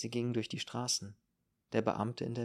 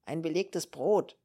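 loud, high, drawn-out order; called-out order; 6 semitones higher, 7 dB louder, slower than usual. A narrator's reading. Landlady orders a sandwich from Franzi, calling out. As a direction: call the order across the room. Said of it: "rief sie dann".

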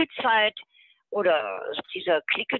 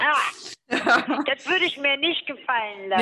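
higher voice, laughing through the words; laughing reply; 6 semitones higher than usual. Real, not acted. S0 joking back to the host, higher laughing reply. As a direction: answer while still laughing.

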